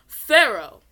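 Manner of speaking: a sharp, loud call of Pharaoh; stern shout. Acted. Angry priest calls for the pharaoh, loud stern shout. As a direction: bark the name like an angry priest.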